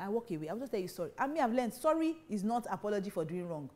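ordinary delivery

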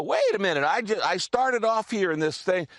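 sudden realization. Pretend. Preacher modelling self-talk for the congregation, sudden realization. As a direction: act out catching yourself mid-argument. Acting out catching oneself mid-argument.

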